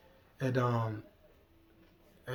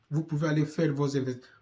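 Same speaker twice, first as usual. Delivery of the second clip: ordinary delivery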